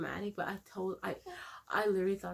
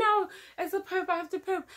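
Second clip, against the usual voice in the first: insistent protest; 10 semitones higher than usual. Retold or imitated. Insisting to nurses, retold.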